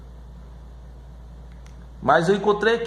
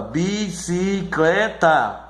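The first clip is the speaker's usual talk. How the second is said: slow, drawn-out "bicicleta", one beat per syllable; teacher slowly sounding out "bicicleta"; much slower than usual. Shown as a demonstration. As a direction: break "bicicleta" into bi-ci-cle-ta. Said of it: "você divide a sílaba".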